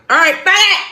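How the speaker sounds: loud goodbye. Real, not acted. Loud sign-off.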